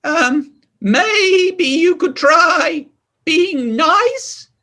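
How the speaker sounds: high, slow, timid small voice; a small sheep daring to speak; little voice. Acted. A little sheep answering King Pig, high timid voice. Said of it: "said a little voice".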